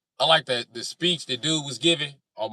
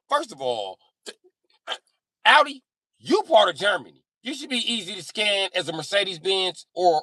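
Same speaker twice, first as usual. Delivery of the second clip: loud, pitched-up angry tirade; exasperated rant; 9 semitones higher, 6 dB louder than usual. Pretend. Mechanic rants at Audi over scanning, loudly exasperated. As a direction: rail at them in exasperated disbelief.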